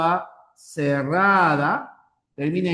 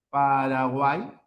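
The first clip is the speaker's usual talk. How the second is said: Paraguay said slowly and clearly; slow clear model; 3 semitones lower, slower than usual. Shown as a demonstration. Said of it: "la palabra Paraguay". (pronunciación lenta y clara)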